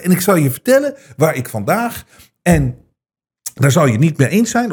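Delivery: ordinary delivery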